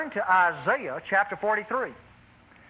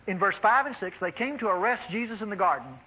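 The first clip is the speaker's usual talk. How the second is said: ordinary delivery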